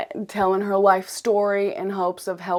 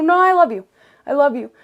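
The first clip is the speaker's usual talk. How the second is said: much higher and louder, forcing the love in; insistent self-love; 7 semitones higher, 10 dB louder, faster than usual. Shown as a demonstration. To herself, overriding the critic with loud insistent love. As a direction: shout the kindness over the critic. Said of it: "Be like".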